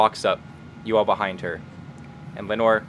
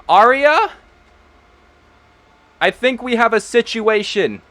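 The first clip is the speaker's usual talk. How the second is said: high, louder call into the house; 11 semitones higher, 4 dB louder than usual. Acted. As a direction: call for Arya, uneasy. Ezekiel calls for Arya.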